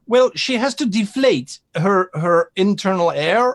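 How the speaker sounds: high merchant voice. Acted.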